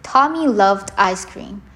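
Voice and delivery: ordinary delivery, nothing marked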